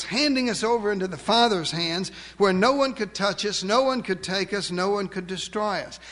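usual speaking voice

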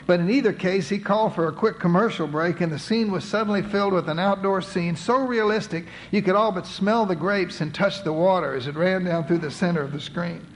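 ordinary delivery

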